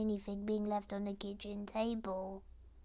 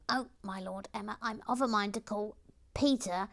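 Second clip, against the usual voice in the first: loud character voice; 8 dB louder than usual. Acted. Character reads chat message aloud.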